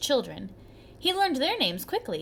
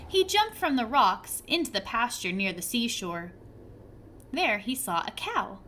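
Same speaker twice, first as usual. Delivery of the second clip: usual speaking voice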